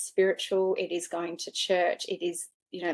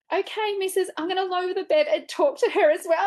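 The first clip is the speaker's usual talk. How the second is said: much higher humouring voice; imitating the nurse; 12 semitones higher, 5 dB louder than usual. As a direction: play the wary nurse going along with it. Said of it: "She goes".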